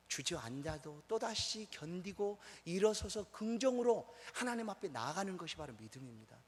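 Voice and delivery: ordinary delivery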